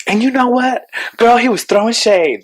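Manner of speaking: imitated straight man, high-pitched and sassy; straight man using slang. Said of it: "the straight man was like".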